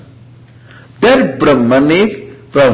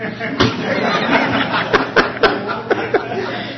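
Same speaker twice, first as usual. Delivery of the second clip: laughter; 14 semitones higher, 8 dB quieter than usual. Real, not acted. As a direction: burst of real laughter. Laughs at his own joke.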